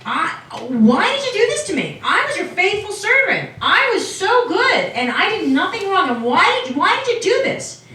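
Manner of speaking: acted anguished protest, pitch soaring; crying out. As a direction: plead up to heaven, hammed up. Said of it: "crying out to God".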